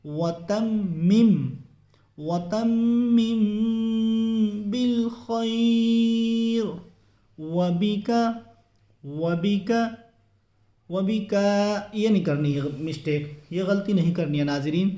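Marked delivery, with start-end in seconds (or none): 0.0-11.3 very slow, melodic recitation. Shown as a demonstration. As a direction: stretch each word in slow chant. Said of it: "تو چلیے پڑھتے ہیں". (آہستہ ترتیل سے تلاوت)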